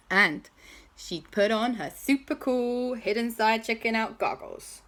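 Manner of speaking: usual speaking voice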